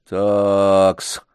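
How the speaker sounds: mocking, drawn-out "Такс"; an old man drawing out "Такс" mockingly; mocking drawl. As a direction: drawl it with scorn. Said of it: "насмешливо протянул дедушка".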